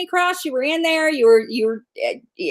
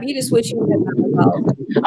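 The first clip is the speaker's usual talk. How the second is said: whispered tease. Real, not acted. Teasing reply to co-host.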